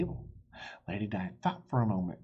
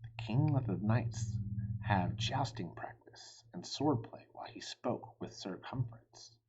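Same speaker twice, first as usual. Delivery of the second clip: ordinary delivery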